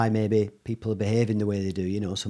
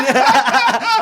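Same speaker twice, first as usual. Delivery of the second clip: loud, high-pitched burst of laughter; 14 dB louder than usual. Real, not acted. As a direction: burst out laughing at your own joke. Laughing at own willy joke.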